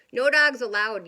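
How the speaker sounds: loud grumpy yell. Acted. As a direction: bark it at the intruder. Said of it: "yelled Hank".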